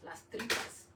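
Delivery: normal talking voice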